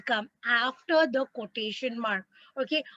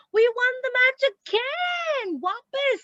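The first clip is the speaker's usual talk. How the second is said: excited cry; 9 semitones higher than usual. Shown as a demonstration. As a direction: call it out with delight, stretched. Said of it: "great emotion".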